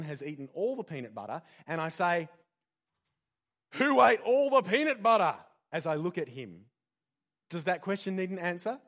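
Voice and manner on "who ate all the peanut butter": much higher, much louder accusing demand; accusing parent. Acted. Dad catching his son who ate the peanut butter: loud accusing demand. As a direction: bellow it at the guilty kid.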